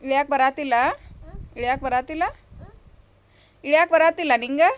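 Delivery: loud parental asking; loud questioning. Real, not acted. Parent calling to child stuck up high.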